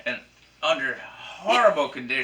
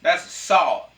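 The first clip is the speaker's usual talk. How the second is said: mock outrage; 3 semitones higher, 9 dB louder than usual.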